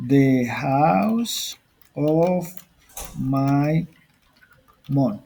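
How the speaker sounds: very slow, with gaps between words; slow word-by-word dictation. Shown as a demonstration. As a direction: draw out each word as if writing it down. Teacher slowly dictates example while typing.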